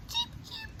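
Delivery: very high, soft cheeping; sparrow cheep. Acted. Reading sparrows' chirp to kids, very high soft. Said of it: "The sparrows chirped".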